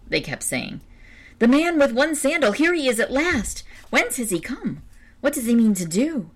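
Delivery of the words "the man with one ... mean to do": high-pitched, astonished exclamations; excited crowd chatter. A narrator's reading. Townspeople to one another as the stranger arrives, excited chatter. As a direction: voice a crowd buzzing with amazed questions.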